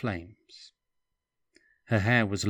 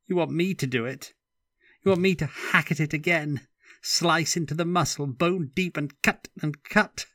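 higher, louder and fast, appalled; horrified outburst; 8 semitones higher, 6 dB louder than usual. A narrator's reading. Stefan refusing to cut Katya, a horrified outburst. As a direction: throw it back at them, aghast.

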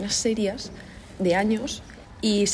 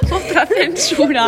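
laughing; 8 semitones higher, 6 dB louder, much slower than usual. Real, not acted. Laughing after swearing on tape.

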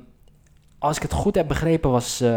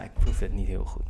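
ordinary delivery

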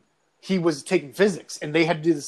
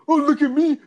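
high, louder mocking singsong; mocking mimicry; 10 semitones higher, 6 dB louder than usual. Teacher jeering at a pupil before the class, mocking.

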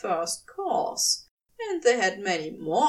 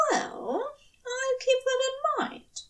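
higher angel voice, pleased; angel amused; 14 semitones higher than usual. A narrator's reading. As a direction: let it sound satisfied. Aziraphale voiced high.

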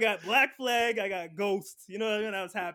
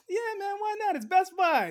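much higher, breezy casual voice; carefree employee; 12 semitones higher, 5 dB louder than usual. Retold or imitated. Best Buy guy brushing off people on Twitter, high breezy voice. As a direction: toss it off like nothing matters.

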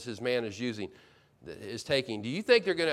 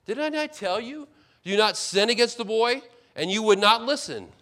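Reuben rebuking; 7 semitones higher, 5 dB louder than usual. Retold or imitated. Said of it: "Reuben answered them".

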